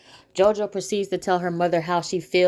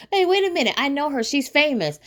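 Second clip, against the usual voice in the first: much higher, imitated starstruck fan; excited recognition; 9 semitones higher than usual. Man telling his girlfriend he knows a star, high excited recognition. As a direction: blurt it high, as if spotting a celebrity.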